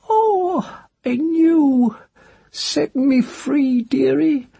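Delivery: high, slow crone plea; a hag begging for freedom; old witch voice. Acted. Cursed witch begs the child to set her free, slow and high.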